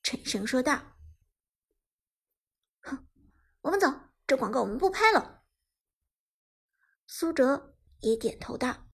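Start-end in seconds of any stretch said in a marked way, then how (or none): 2.8-5.4 fast, indignant dismissal; snort, then call it off curtly and quickly; acted cold indignation; cold snort, stern. A narrator's reading. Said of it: "冷哼一声，沉声说道".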